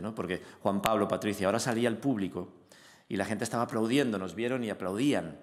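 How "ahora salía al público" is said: usual speaking voice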